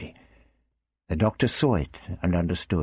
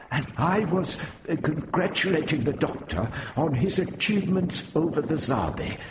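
put-on high, halting, gentle old man; Prapillus, forced politeness; 10 semitones higher than usual. A narrator's reading. Prapillus plays down the row for Barbara, politely. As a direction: swallow your pride and offer grudging courtesy. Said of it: "Prapillus said gently, swallowing hard".

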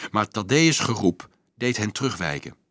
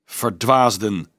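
slow, drawn-out rebuke; loud and slow; slower than usual. A narrator's reading. Priest rebuking the misguided children, slow and solemn. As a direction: stretch it out with solemn authority. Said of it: "sprak hij luid en langzaam".

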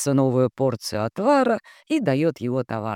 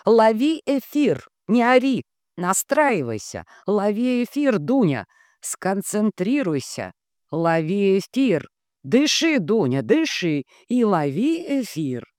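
commanding coach; 8 semitones higher, 3 dB louder than usual. A narrator's reading. Tatiana commands Dunya to tune in.

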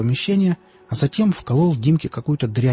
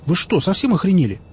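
higher, indignant demand; 7 semitones higher than usual. A narrator's reading. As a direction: still outraged, hit back. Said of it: "возмутился он".

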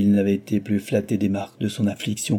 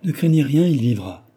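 hiding terror; 5 semitones higher, 5 dB louder than usual. A narrator's reading. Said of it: "cherchant à déguiser sa terreur".

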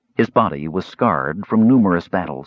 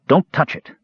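a touch raised, quick warning; said quickly; 6 semitones higher than usual. A narrator's reading. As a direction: say it quickly, higher. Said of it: "the warrior said quickly".

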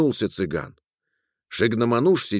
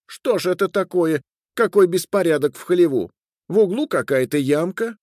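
high, fussing wife's voice; complaining wife; 8 semitones higher than usual. Acted. Wife complaining to her husband about disorder, high and fussy. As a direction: fuss about the mess in a high pitch.

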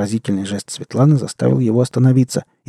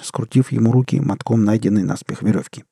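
ordinary delivery, nothing marked

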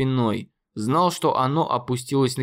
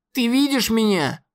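raised voice, stress on видишь; shouting; 9 semitones higher than usual. Acted. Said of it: "закричал Конор".

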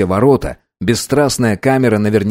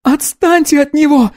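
high desperate scream at the captain; shout. Acted. Anna screaming at the captain to leave the boy alone. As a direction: yell to protect her brother. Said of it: "закричала Анна".

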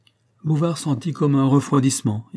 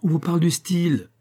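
raised, higher voice cutting in; cried out; 7 semitones higher than usual. A narrator's reading. Pécuchet cries out at the notary, raised voice. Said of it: "cria Pécuchet".

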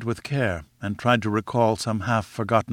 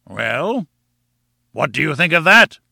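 a triumphant, louder challenge; triumphant cry; 5 semitones higher, 6 dB louder than usual. A narrator's reading. Professor crowing in triumph over the narrator, louder. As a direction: crow it out in triumph. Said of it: "cried the professor".

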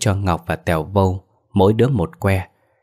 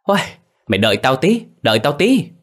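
high, startled holler; panicked call to wait a moment; loud yell; 7 semitones higher than usual. A narrator's reading. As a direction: yell it in a fluster, rising on the repeat. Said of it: "đoạn la lớn".

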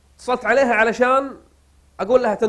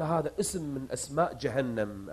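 hushed grave answer; 8 semitones lower, 13 dB quieter than usual. Retold or imitated.

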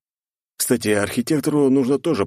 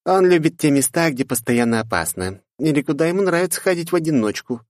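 put-on sulky girl voice; 9 semitones higher than usual. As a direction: complain with a huff. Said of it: "Лифа хмыкнула". (обиженная девушка)

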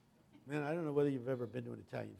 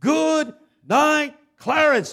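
high, loud final good night; one last shout at the casket; yelled farewell; 14 semitones higher, 16 dB louder, much slower than usual. Retold or imitated. Pastor yelling one last farewell before slamming the lid. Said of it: "he yelled one last time".

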